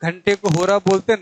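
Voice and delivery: usual speaking voice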